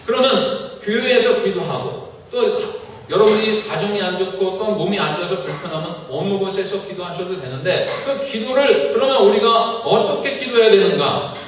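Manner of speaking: ordinary talk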